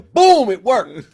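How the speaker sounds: high, booming burst; triumphant exclamation. Real, not acted. Host celebrates that sending his brother worked, triumphant shout. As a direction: blast the first word out in triumph.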